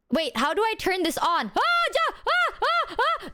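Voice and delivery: high, flustered mock yelling; hammed-up panicked squeals. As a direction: squeal in panic fumbling a camera. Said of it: "It's just me yelling".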